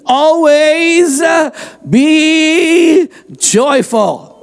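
high, very slow stretched verse; emphatic scripture reading. Acted. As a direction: draw every word out high. Preacher reads verse emphatically.

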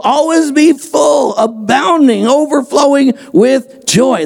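high, very slow stretched verse; emphatic scripture reading. Acted. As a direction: draw every word out high. Preacher reads verse emphatically.

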